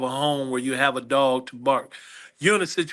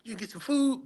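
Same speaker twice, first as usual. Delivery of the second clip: mimicking eager staff; 10 semitones higher, 6 dB quieter than usual. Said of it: "they was like".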